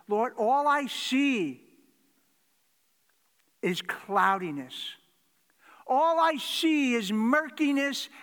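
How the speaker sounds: slow, loud, high pleading prayer; fervent preaching. Acted. Preacher voicing David's slow loud plea for light. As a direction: drag out each plea to God.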